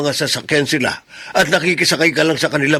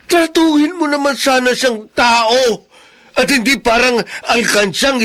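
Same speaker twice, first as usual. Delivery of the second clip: higher, anguished pleading; impassioned plea; 8 semitones higher, 4 dB louder than usual. Acted. Crippled father pleading with wife about Alfred, impassioned. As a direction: beg her to treat Alfred fairly.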